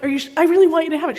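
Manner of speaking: fast, loud, high insistence; breathless eager plea; rushed pleading. Retold or imitated. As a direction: tumble the words out urging her. Pleading with shopkeeper to accept.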